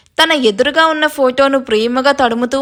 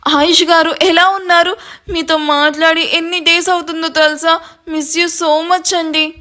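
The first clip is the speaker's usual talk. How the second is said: high-pitched, overcome greeting; eager, lifted voice full of longing; emotional greeting; 5 semitones higher than usual. A narrator's reading. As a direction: pour out how much you missed them. Overjoyed, tearful greeting of missed husband.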